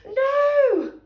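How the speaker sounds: high, loud cry of no; terrified cry. Acted. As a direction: scream it in fright. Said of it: "cried the witch".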